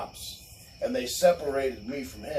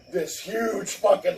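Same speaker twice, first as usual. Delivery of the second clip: normal talking voice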